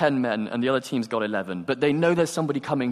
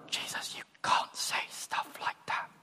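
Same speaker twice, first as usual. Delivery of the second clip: hushed, urgent whisper; private whisper; 10 dB quieter than usual. Acted. Peter privately rebuking Jesus, whispered. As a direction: whisper so the others can't hear. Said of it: "he's trying to make it private".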